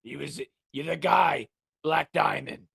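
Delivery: low, fast mock German; German accent. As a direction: rattle it off like a German rocker. Mocking Klaus's accent.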